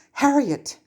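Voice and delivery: a sharp, urgent call of her name; urgently grabbing her friend's attention. A narrator's reading. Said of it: "Granger said urgently".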